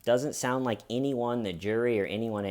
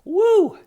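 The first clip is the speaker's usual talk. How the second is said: very high, loud whoop; spicy whoop; 11 dB louder than usual. Real, not acted. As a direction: yelp from the heat. Spicy snack reaction.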